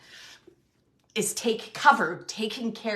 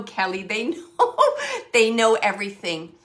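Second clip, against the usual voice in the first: laughing; 10 dB louder than usual. Real, not acted. Laughing while telling Kelly.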